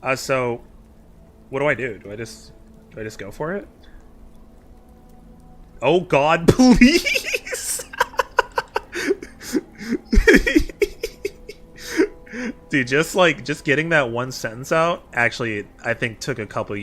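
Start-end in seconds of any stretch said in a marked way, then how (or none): 5.8-10.7 embarrassed laughing. Real, not acted. Embarrassed after doing the voice.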